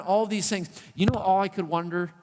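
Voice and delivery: ordinary talk, nothing marked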